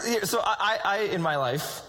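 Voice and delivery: ordinary talk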